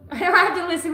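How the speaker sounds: mock teenage defiance, loud; hammed-up rebellious teen; bratty teen. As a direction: yell it like a sulky kid at a stepmom.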